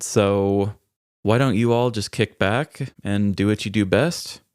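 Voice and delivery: ordinary talk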